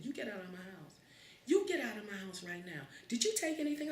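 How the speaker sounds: high, mock furious mother ordering out; hammed-up stern eviction; angry mother. Mother throwing the kid out of the house, mock angry.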